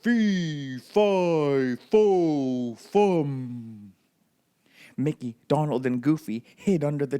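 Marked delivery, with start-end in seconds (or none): 0.0-3.9 a hint of a booming giant; mild giant roar, word by word. A narrator's reading. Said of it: "A voice roared out".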